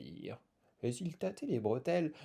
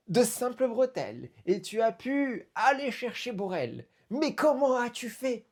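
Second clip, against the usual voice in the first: elated, swinging up high and loud; overjoyed; 8 semitones higher, 3 dB louder than usual. Acted. Balsu welcomes his friends back from the hole, overjoyed. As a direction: greet them with delighted amazement. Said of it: "Balsu était aux anges".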